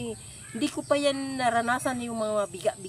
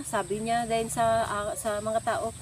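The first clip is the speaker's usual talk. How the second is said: ordinary talk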